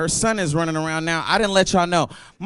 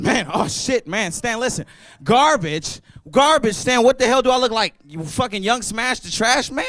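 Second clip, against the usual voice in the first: retold outburst; 6 semitones higher than usual. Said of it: "And I'm like".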